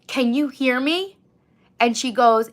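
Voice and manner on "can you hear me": higher, slow and pointed; slow pointed question. Retold or imitated. To the ignoring worker, a slow pointed question. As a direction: lean on each word, fed up. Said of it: "so finally I said".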